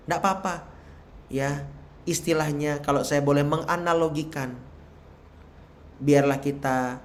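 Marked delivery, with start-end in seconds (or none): none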